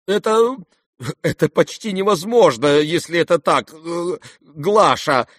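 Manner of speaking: high, halting, flustered stammer; greatly agitated. A narrator's reading. Father falters before his daughter, greatly agitated. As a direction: stumble for words, badly rattled. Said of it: "сильно волнуясь".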